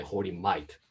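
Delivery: "Hoary Might" with Australian "mate" said like "might". Shown as a demonstration. Speaker reads his talk title, Australian "Hoary Might" rhyming with Night. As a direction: say "Might" the Aussie way so it rhymes with Night. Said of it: "mate should be pronounced in Australian accent to rhyme it".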